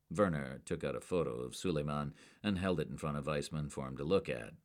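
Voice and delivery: ordinary delivery, nothing marked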